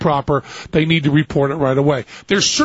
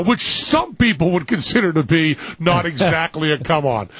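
ordinary delivery

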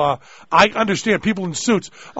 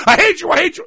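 high, raised-pitch pretend rage; mock hateful outburst; 14 semitones higher, 5 dB louder, faster than usual. Son to father under the pillow, mock frenzied hatred. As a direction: play a son smothering his father, hammed-up.

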